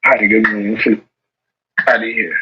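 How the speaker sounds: ordinary talk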